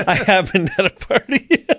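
chuckling through a mock slogan; laughing. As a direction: read it like a joke T-shirt, giggling. Joking T-shirt slogan.

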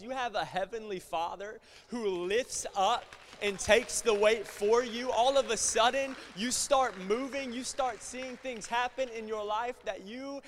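high, loud, impassioned sermon climax; full-force preaching. Real, not acted. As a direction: build to a shouted declaration of faith.

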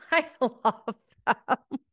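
laughing. Real, not acted. Laughing after retold quote.

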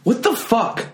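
high-pitched mock offence; comic indignation, pitched well up; imitated stand-up voice. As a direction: imitate a comedian's offended outburst. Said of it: "you do it very, like".

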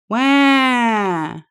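high loud wail; crying wah. A narrator's reading. Lonely beaver wailing on the pond. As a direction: let out a child's wail.

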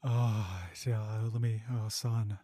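low mock moans of pleasure; exclaiming in pleasure. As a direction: groan with mock delight. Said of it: "I was exclaiming in pleasure".

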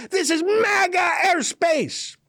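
high, drawn-out triumphant yell; mock scream. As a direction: scream it out like a hammed-up battle cry. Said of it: "while screaming".

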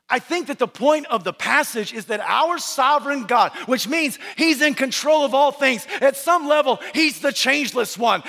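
high, driving pulpit cadence; forceful preaching. Real, not acted. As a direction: preach it in relentless, building declarations.

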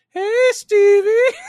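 high, drawn-out goofy call; dumb voice. Retold or imitated. Tour guide calling to a director from a golf cart in a dumb voice. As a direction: sing out a dopey greeting to a stranger. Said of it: "with a dumb voice".

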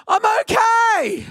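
high, drawn-out mock all-clear; slow, high-pitched comic holler; hammed-up shout. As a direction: call uphill insisting you're fine, comically high. Calling up to friend after crash.

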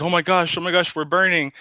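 high-pitched alarmed muffin; mock panic. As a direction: act out a joke character crying out in alarm. Voices a panicking muffin in a joke.

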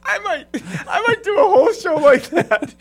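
high, loud, laughing squeal; put-on voice. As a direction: giggle through a very high put-on voice. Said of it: "I could use that voice".

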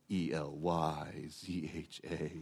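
a hint of a clueless speller, soft and slow; silly misspelling. Preacher mimics someone misspelling Elijah, soft and slow. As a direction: spell it out wrong, half-mocking. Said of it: "So silly".

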